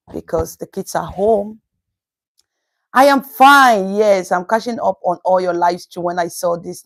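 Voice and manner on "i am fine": high, slow, beaming reply; bright answer. Real, not acted. Streamer telling a viewer she is fine, beaming. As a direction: stretch it out happily.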